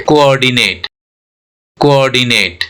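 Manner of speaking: कोऑर्डिनेट as 'ko-or-di-nate', measured and falling; two separate o's. Shown as a demonstration. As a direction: keep both o's apart in कोऑर्डिनेट. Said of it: "दोनों ओ अलग-अलग sound हो रहा है". (कोऑर्डिनेट में दोनों ओ अलग-अलग)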